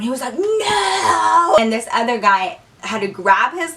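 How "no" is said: put-on high, long drawn-out drunken no; drunk refusal. Drunk roommate to the guys holding him, whiny refusal. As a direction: imitate a very drunk guy refusing. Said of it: "Peanut Butter was so drunk".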